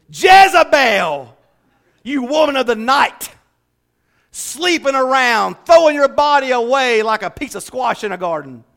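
hammed-up angry denunciation, loud and high; mock wrathful scolding; angry, vengeful. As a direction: draw out the name-calling in mock outrage. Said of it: "if Jesus was angry and vengeful".

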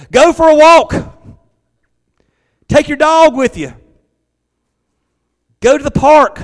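high, loud preacher's exhortation; full-force preaching. Acted. Pastor urging church to turn off the news, loud preaching. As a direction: urge the church to switch off.

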